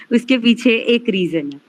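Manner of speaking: ordinary delivery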